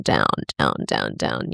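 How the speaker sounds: very low, sinking repetition; spiralling down. Shown as a demonstration. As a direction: drop the pitch deep on each word. Acting out spiralling downwards.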